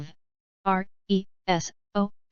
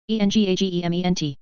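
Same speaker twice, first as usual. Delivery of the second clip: quick letter run; brisk recap spelling; fast spelling; much faster than usual. Shown as a demonstration. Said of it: "spelling drill, slow then fast".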